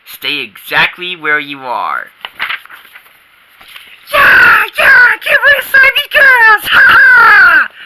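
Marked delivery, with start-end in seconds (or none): 4.1-7.7 very high, loud triumphant yell; gleeful war whoop. Acted. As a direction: whoop as the apples fly.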